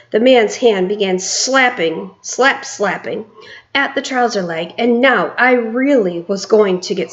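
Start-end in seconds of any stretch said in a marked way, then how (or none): none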